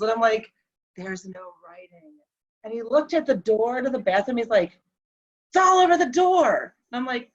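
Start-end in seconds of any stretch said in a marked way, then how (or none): 1.0-2.1 much softer, lower reply; hushed denial. Retold or imitated. Wife to hallucinating husband, hushed denial. 5.5-6.8 retold insistence, higher and louder; agitated insistence. Hallucinating husband to wife about the door, agitated insistence.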